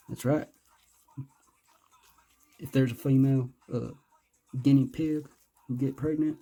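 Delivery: ordinary talk, nothing marked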